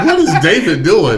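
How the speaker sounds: baffled at the brother rushing the field; amused disbelief. Retold or imitated.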